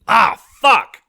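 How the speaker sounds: loud, high swearing burst; cursing outburst. Acted. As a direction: blurt it out loud in alarm. Blitz spots his ex.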